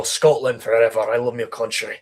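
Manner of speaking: hammed-up Scottish accent, proud of his country. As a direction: play a patriotic Scot with a thick accent. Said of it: "he's just kinda like this".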